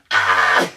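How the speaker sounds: loud, low harsh "Ah!"; witch-like cry. Shown as a demonstration. Showing what the whole video was, loud witch-like cry. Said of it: "was me doing that".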